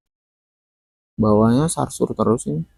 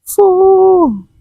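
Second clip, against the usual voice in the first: one hard puff of breath; blowing; 6 dB louder than usual. Pretend. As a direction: blow out hard like on a candle. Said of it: "Yo, lagi satu".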